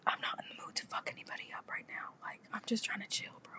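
hushed whisper so the man outside won't hear. Real, not acted. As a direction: breathe it, barely audible.

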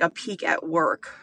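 ordinary talk

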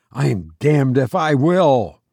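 usual speaking voice

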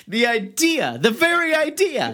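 high, scandalized exclamation; mock outrage. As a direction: play the offended old-fashioned prude.

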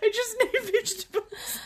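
high, tearful, giggling; mock teary insistence. Speaker on the floor insisting to Tony, high tearful voice. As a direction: insist pathetically.

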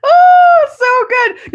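high, loud burst of delight; delighted shriek. Real, not acted. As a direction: squeal with delight. Laughing delight at the story.

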